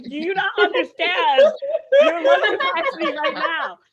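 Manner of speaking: laughing mock scold to the cat; exasperated yell. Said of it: "And I was like".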